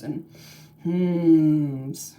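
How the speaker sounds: plain reading voice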